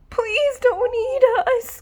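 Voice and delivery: high, frightened begging; pleading cry. Acted. Dogs begging the monster, high frightened plea. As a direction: beg the monster for mercy. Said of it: "cried the dogs".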